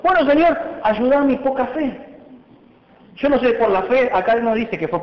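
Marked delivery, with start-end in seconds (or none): 0.0-2.0 pleading father. Acted. The father begging Jesus for more faith, high plea. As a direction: cry out for help in earnest. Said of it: "Este hombre le dijo".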